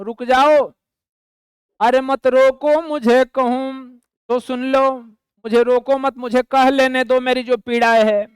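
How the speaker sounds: ordinary delivery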